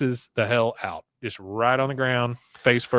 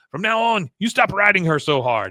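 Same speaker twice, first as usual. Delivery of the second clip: high-pitched mock-angry order; Con, pissed; 7 semitones higher, 4 dB louder than usual. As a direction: lay down the law in a raised character voice. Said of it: "Con is coming up pissed".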